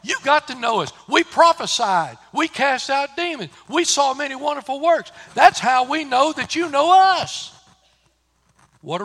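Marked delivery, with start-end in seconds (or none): 0.0-7.5 loud, higher, pleading voice of the crowd. Retold or imitated. The earnest crowd pleading with the Lord to know them, loud and high. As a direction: beg the Lord to recognise you. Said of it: "they said".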